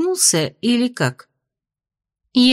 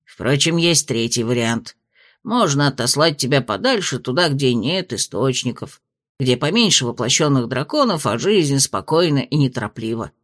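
lower, measured spirit voice; low dragon spirit voice; 7 semitones lower than usual. A narrator's reading. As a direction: speak as a wise old dragon spirit. Spirit's third option.

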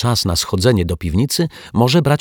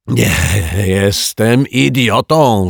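louder, slow shout; indignant yell; 4 semitones higher, 4 dB louder, slower than usual. Acted. The lodger yelling at the detective, offended. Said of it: "wrzasnął".

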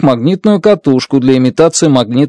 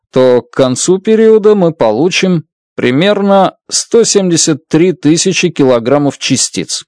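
ordinary delivery, nothing marked

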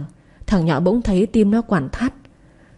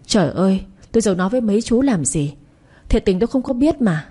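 usual speaking voice

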